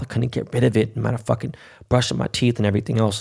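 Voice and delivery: normal talking voice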